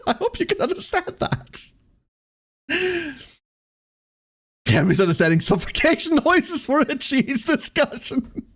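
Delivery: high, strained words squeezed through laughter; words barely out between laughs; laughing while talking. Real, not acted. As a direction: try to talk but keep cracking up.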